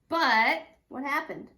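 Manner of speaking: plain reading voice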